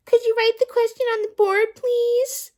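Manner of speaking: mock the child's polite question. A narrator's reading. Teacher mocking Rondi's question. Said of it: "In a mocking voice".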